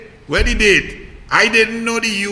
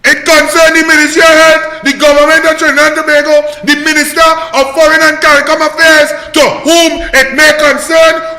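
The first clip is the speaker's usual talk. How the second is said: loud, higher indignant shouting; furious rant; 6 semitones higher, 7 dB louder than usual. Real, not acted. Blasting the US embassy over the vaccine gift, furious shout. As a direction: shout your outrage at them.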